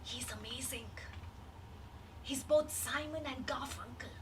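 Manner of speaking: hushed awed whisper. Retold or imitated. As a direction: whisper it like a secret. Friend's awed remark quoted.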